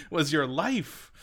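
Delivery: high, drawn-out baffled question; laughing disbelief. Retold or imitated. Viewer hearing of his life then happy little trees, laughing disbelief. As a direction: stretch it out in amazement through laughter.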